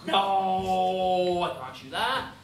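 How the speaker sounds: loud, long-drawn "no"; acted protest. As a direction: draw out a big stubborn refusal. Hans refuses the target.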